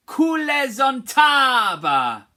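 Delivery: slow, high, loud Kule zontaba; teaching call. Shown as a demonstration. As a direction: call it out for the class. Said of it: "teacher leads the phrase".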